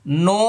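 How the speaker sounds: নুন held very long and high, lips round then open; slow tajweed demo of নুন; letter name drawn out. Shown as a demonstration. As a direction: stretch নুন on one note. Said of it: "আমি আবার বলছি".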